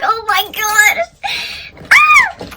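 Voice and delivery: drawn-out high cry of panic; panicked wail. Real, not acted. Running late to pick up her man, panicked wail. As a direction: stretch it out into a sudden high scream.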